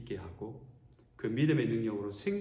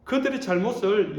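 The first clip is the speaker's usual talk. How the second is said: ordinary delivery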